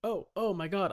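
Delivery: normal talking voice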